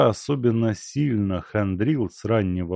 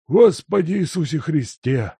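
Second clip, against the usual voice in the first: put-on higher, louder old man's alarmed exclamation; horrified cry; 8 semitones higher, 5 dB louder than usual. A narrator's reading. Servant startled by the guest, crying out in horror. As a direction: recoil at the sight of the guest. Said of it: "ужаснулся Лука".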